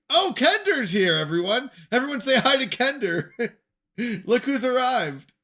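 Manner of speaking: loud, high announcement of an arrival; excited welcome. Real, not acted. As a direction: hail the newcomer to the whole room, loudly. Greets a viewer who arrives.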